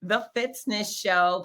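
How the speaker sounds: ordinary talk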